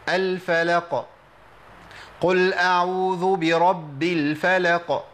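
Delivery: slow recited قل اعوذ برب الفلق; Quran recitation. Shown as a demonstration. Teacher reciting a verse to show the second level. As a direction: recite slowly, bouncing the final qaf. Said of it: "المرتبه الثانيه".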